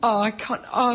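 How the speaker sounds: usual speaking voice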